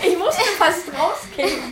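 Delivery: talking through laughter; laughing. Real, not acted. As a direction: speak while still laughing. After prank call, laughing. (lachend gesprochen)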